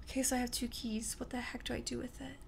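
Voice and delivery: hushed whisper to herself; nervous whisper while playing. Real, not acted. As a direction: keep it a breathless whisper. Whispering to self in scary game.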